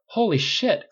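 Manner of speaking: high, loud startled exclamation; exclaiming. A narrator's reading. Clint gasping at the giant turkey, loud and high. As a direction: blurt it out, shocked. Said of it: "Clint called".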